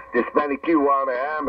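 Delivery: ordinary talk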